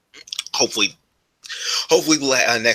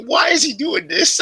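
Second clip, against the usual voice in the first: high squeaky imitation of his mom; mom impression; 13 semitones higher than usual. Son imitating his mom's confusion in a high squeaky voice. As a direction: raise the pitch, mock bewilderment. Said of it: "she's like".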